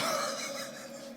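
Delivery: brief breathy chuckle; schemer's quiet laugh; stage laugh. Acted. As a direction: laugh softly at your own scheme. Don Juan laughs at his scheme.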